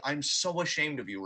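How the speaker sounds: normal talking voice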